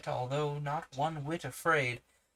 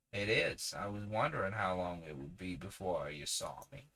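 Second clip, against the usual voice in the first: low drawling woodland tramp; 6 semitones lower, 4 dB quieter than usual. Shown as a demonstration. Tramp answering Uncle Felix, low and drawling. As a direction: lean into a slow rural drawl. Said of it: "a little bit more of a drawl".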